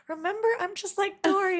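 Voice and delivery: playful excuse. Retold or imitated. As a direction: hold up Dory as a cheeky excuse. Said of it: "and I went".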